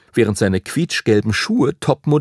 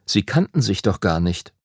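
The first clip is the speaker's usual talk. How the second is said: plain reading voice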